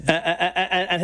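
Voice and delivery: ordinary talk, nothing marked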